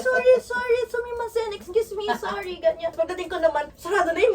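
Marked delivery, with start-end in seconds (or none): 0.0-2.5 high, hurried sorry through a crowd; frantic apologies. Retold or imitated. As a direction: squeeze past strangers apologizing. Apologizing while running past people.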